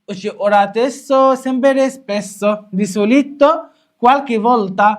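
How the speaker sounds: slow recital, each word drawn out; slow deliberate classroom chant; slow drill. Shown as a demonstration. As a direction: spell the list out slowly for the class. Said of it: "repeats list slowly".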